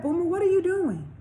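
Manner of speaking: put-on a mom calling to her boy, pitched high; mother's voice. Mother checks on her son.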